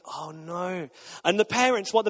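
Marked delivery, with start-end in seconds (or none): 0.0-0.9 quiet dismay. Retold or imitated. Dad's quiet dismay watching daughter.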